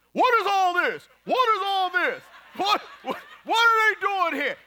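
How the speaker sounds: high, loud, hammed-up protest; shrieked mock outrage. Cheater confronted at restaurant by cameras, high indignant shout. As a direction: yelp it like a caught cheater. Acts out caught cheater's outburst.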